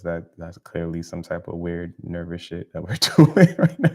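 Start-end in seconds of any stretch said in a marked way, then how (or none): 3.0-3.8 words breaking into louder laughter; laughing. Real, not acted. Host laughing at their own weird accent slip.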